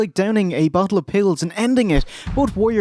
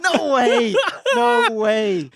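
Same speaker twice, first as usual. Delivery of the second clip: surprised; 7 semitones higher, much slower than usual. Real, not acted. Mock disbelief at friend's pick.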